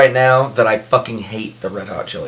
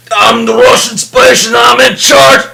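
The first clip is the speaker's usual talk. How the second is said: loud, hammed-up Russian accent, slower and higher; 12 semitones higher, 7 dB louder, slower than usual. Imitating the Armageddon cosmonaut for his cohost, loud mock Russian accent. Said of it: "we've been doing these accents".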